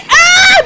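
sudden high yelp; loud startled cry; startled shout. Real, not acted. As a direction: jump at the surprise. Sudden attack in game.

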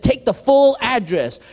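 dad laying down the law, slow and loud; stern dad. Retold or imitated. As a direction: spell out the rule to a careless son. Strict dad giving an order.

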